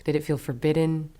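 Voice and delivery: ordinary talk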